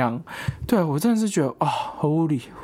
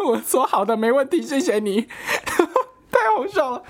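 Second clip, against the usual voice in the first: laughing; 9 semitones higher than usual. Real, not acted. Laughing at the guard's excuse.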